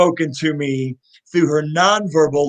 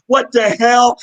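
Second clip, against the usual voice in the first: louder, higher mock shock; imitating a stunned worker's what the hell; shocked exclamation; 6 semitones higher, 6 dB louder, slower than usual. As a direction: throw it out like a startled worker. Stunned drive-through worker's reaction.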